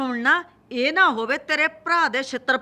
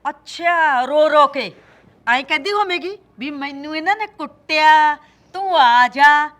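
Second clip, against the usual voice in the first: loud sarcastic mimicry; mock her tearful call loudly; mocking sneer; 2 semitones higher, 7 dB louder than usual. Mocking daughter-in-law's phone call. (ਸੱਸ ਦਾ ਨੂੰਹ ਦੀ ਉੱਚੀ ਨਕਲ ਉਤਾਰਨਾ)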